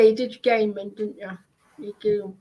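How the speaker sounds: ordinary delivery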